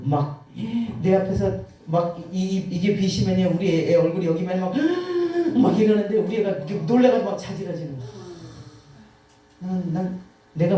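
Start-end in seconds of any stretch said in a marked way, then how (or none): 4.8-5.5 startled gasp. Retold or imitated. Child startled by axe.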